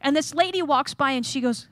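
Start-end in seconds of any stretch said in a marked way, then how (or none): none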